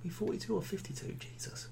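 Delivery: hushed reckoning to oneself; whispered aside. Real, not acted. Host working out a future age, whispered aside. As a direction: whisper it under your breath.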